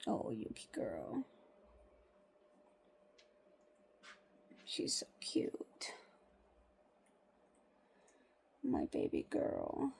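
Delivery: slow, hushed whisper. Real, not acted. Owner whispering slowly to her dog. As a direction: breathe it softly to her.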